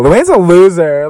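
mock yell. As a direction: call it out across a dinner table. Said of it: "Carol yells out".

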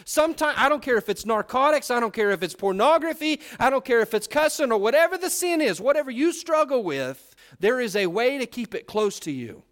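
high, loud, driving sermon voice; full-force pulpit preaching; fiery preaching. Acted. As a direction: preach it hard at the congregation.

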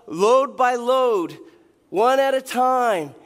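loud, exasperated sermon delivery; preaching at full force. Acted. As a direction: drive it home, fed up. Heated retelling, louder preaching.

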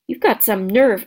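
plain reading voice

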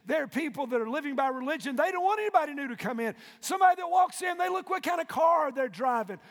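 rising sermon crescendo, hammering the point; preacher climbing into a shout; preaching at full force. Acted.

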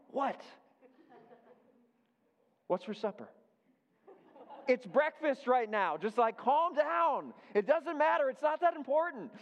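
put-on calm the kid down with strained patience; exasperated parent.

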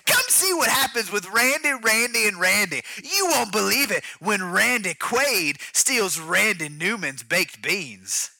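high, drawn-out announcer hype; mock TV promo announcer. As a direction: sell the new show with big energy.

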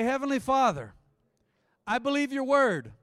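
loud, high opening of a prayer; prayer lead. Acted. Leads congregation in prayer.

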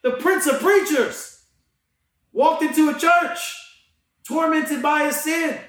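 rising into a full excited preach; fired up. Real, not acted. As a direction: tell it like you're lighting up the room. Fired-up retelling of Spurgeon story.

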